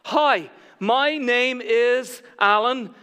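loud, slow, deliberate introduction; high, drawn-out declaration; said on purpose. Acted. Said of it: "I'm going to say it on purpose".

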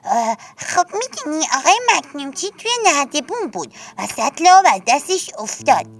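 put-on eager child voice. A narrator's reading. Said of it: "برای اینکه کمکی کرده باشه گفت".

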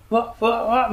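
high, light imitation of baby fussing; baby fussing sounds. Parent imitating a baby's fussing before crying, high mock sounds. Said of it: "みたいなのはあるよ".

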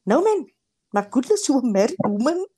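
high, emphatic imitation of mom telling off; loud scolding mother. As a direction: mimic mom's nagging voice, coming through loud and clear. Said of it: "hear that woman's voice coming loud and clear".

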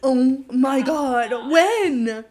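loud, drawn-out disbelief; real shock. Real, not acted. Co-host hearing who made out with whom, loud astonishment. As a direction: react to juicy gossip, high and slow. Co-host reacts to surprising gossip.

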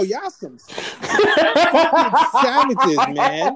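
slow, high, loud words stretched through laughter; laughing accusation. Real, not acted. Fan calling cohosts savages through laughter. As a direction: drag it out while laughing.

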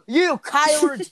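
put-on high, stretched country-accent shout. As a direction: imitate an angry teacher with a country twang. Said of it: "your country accent".

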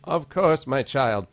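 lilting grandmotherly character voice; grandmotherly gnome voice. Acted. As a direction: welcome them in as an elderly gnome priest. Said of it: "your gnome voice is perfect for a grandmother".

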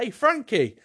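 high, loud hail; mock calling out. Someone calling Frankie to get attention, calling out. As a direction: call out to someone by name.

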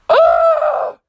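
high, loud "ugh" of frustration; frustrated groan. Real, not acted. Ranting to viewers about bad parents, exasperated shout.

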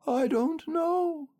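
slow, very high doleful bear; rather doleful. A narrator's reading. Pooh answering Piglet, rather dolefully. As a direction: draw it out high and woeful. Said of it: "said Pooh rather dolefully".

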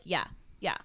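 low, brushed-off yeah; dismissive. Retold or imitated. Celebrity brushing off a birthday fan, dismissive. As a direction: imitate someone dismissing a fan. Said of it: "like, very dismissive".

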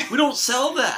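high, loud, incredulous clerk; clerk's exasperated refusal. Acted. Mock fast-food clerk refusing customer.

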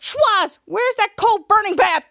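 high, loud imitated demand; put-on impatient call; mock bossy shout. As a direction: holler for the helper, hammed up. Imitates boss calling out a demand.

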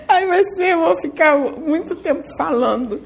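high, laughing aside; real laugh while wrapping up. Real, not acted. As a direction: giggle through the words, amused at herself. Laughing at her own long talk.

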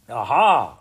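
loud royal "gotcha" cry; triumphant cry. A narrator's reading. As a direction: pounce on the slip loudly. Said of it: "cried the Queen".

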